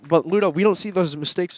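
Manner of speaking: loud, high commentary coming down from the chance; excited play-by-play. Real, not acted. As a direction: keep the excitement up after the miss. Commentary after the shot.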